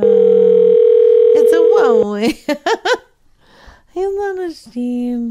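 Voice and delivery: slow, loud pet-talk coo. Real, not acted. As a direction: coo it slowly like to a hurt puppy. Cooing over dog's ear.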